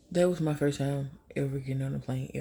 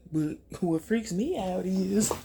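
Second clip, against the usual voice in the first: ordinary delivery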